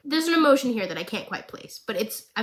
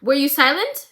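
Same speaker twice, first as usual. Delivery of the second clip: lightly voiced cold accusation; Savika's line; 3 semitones higher, 6 dB louder than usual. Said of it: "And Savika goes".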